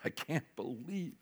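soft, slow, mock-tearful disbelief; imitated weeping. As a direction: voice his regret in a hushed, slow murmur.

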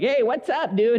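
put-on high, breezy kid voice; American kid greeting. Kids greeting the new boy at school, high casual voice. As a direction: sound like a cheerful schoolkid. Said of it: "kids are coming up to me like".

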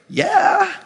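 high-pitched acted yeah; eager agreement. Imagined reply to God's offer.

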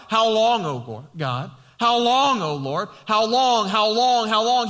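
loud anguished how long cries; crying out. Retold or imitated. Pastor voicing David crying out to God, loud and anguished. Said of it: "he cries out".

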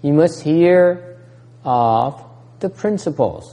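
You must hear drawn out slow and loud; preacher hammering the point; slow emphasis. Shown as a demonstration. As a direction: lay weight on hear. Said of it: "what must you hear".

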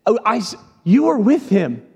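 pressing harder on "You were"; insistent accuser. Acted. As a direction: pin him down. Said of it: "comes up and says".

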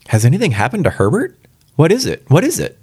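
high, quick anxious questions; breathless alarm. A narrator's reading. Startled mother asking the visitor about Herbert, breathless and high. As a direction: press for news, pitch climbing with each question. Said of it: "she asked breathlessly".

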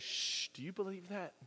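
soft hushed preacher aside; hushed youth minister. Retold or imitated. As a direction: lean in and hush them like a youth minister. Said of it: "the youth minister goes".

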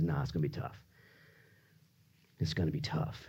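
very low, soft answer; hushed, low voice of God; quiet refusal. Acted. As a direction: break it to them quietly. God's low answer to a plea.

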